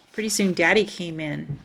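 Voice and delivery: plain reading voice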